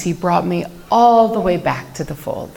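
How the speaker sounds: ordinary talk, nothing marked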